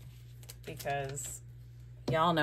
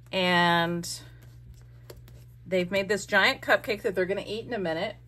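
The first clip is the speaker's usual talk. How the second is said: normal talking voice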